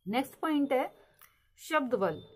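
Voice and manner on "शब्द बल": usual speaking voice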